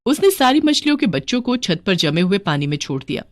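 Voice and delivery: usual speaking voice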